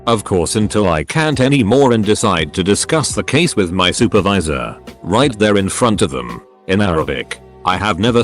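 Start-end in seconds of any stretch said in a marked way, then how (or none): none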